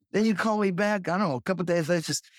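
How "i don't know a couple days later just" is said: ordinary talk, nothing marked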